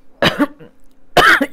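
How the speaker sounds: harsh choking cough; choking on a drink. Real, not acted. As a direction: cough hard as a drink goes down wrong. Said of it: "j'ai bu de travers".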